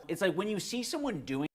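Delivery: ordinary talk, nothing marked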